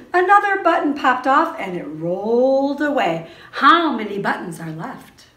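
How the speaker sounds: lively, sing-song read-aloud for toddlers; reading to children. A narrator's reading. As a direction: read it like story time in class. Teacher reads book to preschoolers.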